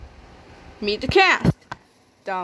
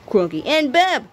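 ordinary delivery, nothing marked

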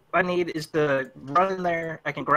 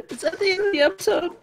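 laughing; 13 semitones higher, faster than usual. Real, not acted. Laughing at sudden ending.

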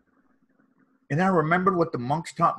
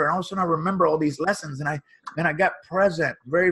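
ordinary talk

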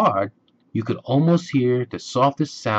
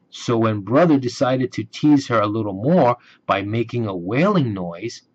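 usual speaking voice

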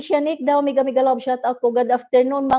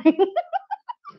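laugh; 7 semitones higher than usual. Real, not acted. After joking about Capri.

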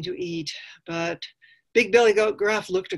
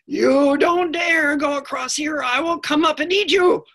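threatening park ranger; 10 semitones higher, 8 dB louder than usual. Acted. Park ranger forbidding medium goat to cross, loud and menacing. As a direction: stretch out a fierce warning. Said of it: "and she said".

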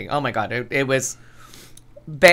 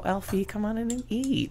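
neighbor ladies; 6 semitones higher, 11 dB quieter than usual. Retold or imitated. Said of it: "they would always go".

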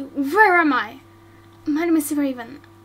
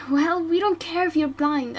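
normal talking voice

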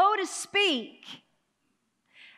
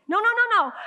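emphatic no; 6 semitones higher, 5 dB louder, faster than usual. Real, not acted. Preacher rejecting the attitude.

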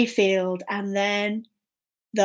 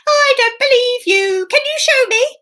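very high storybook cat voice; high-pitched, disbelieving cat; doubting Puss; 12 semitones higher, 4 dB louder than usual. Acted. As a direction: challenge the ogre in a high, teasing pitch. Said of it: "And Puss said".

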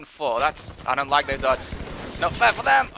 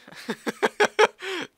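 burst of high laughter; 6 dB louder than usual. Real, not acted. Shocked by instant kill.

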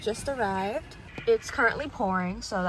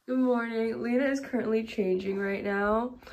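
ordinary delivery

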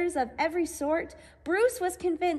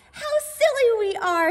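giggling; 7 semitones higher, 6 dB louder than usual. A narrator's reading. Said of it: "exploded in giggles".

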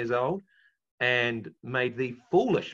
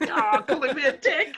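high laughing cry of realization; 5 dB louder than usual. Real, not acted. Laughing at his friend's joke. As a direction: burst out laughing at the pun. Mishearing Duck as dick, laughing.

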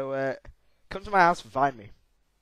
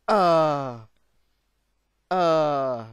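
loud drawn-out "ah"; frustrated cry; 6 dB louder than usual. Real, not acted. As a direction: let out long loud cries of frustration. Frustrated cries at the game.

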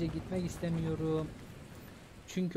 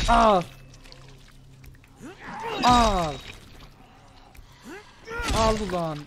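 furious shout; 8 semitones higher, 12 dB louder than usual. Real, not acted. Gamer shouting at a zombie, furious. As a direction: roar it as you swing.

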